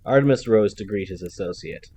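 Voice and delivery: ordinary delivery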